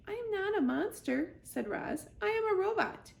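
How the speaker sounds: high, even robot voice; calm robot. Acted. Roz the robot answering the animals, even and high. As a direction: state it plainly, machine-calm.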